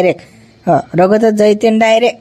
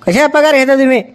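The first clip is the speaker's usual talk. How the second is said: scolding; 6 semitones higher than usual. Acted. Doctor scolds staff member.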